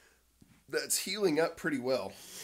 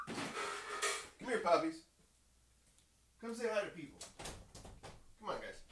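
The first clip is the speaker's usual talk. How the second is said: talking to dogs; 8 semitones higher, 12 dB quieter than usual. Real, not acted. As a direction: coo at the dogs to lure them over. Calling the dogs over.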